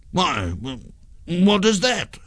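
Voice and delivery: stammering, high-pitched puzzlement; baffled ghost tripping over the question; extremely puzzled. A narrator's reading. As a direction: ask it completely bewildered, voice rising high. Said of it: "extremely puzzled".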